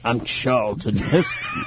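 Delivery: low, slow Heston impression; Charlton Heston voice. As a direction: drop low for a hint of Heston. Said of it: "He had the low voice that he spoke with".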